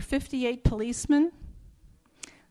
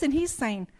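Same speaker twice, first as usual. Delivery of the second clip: ordinary talk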